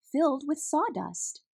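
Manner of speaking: ordinary delivery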